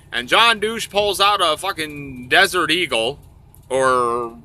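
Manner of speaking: normal talking voice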